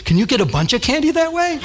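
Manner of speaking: put-on high, scheming kid's question; eager sister.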